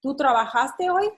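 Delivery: rising tune on "hoy" in "¿Tú trabajaste hoy?"; teacher modelling a question rise; question intonation. Shown as a demonstration. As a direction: ask it, with "hoy" rising at the end. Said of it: "how it changed the intonation".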